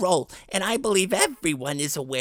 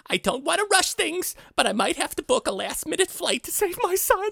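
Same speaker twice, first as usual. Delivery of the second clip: mock panic; 8 semitones higher than usual. Mock panic over son.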